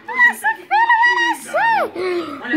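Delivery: high, slow joyful cry; excited. Acted. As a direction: announce the birth with joy.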